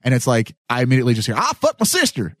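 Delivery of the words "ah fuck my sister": mock Southern accent. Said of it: "then you come off with a Southern accent".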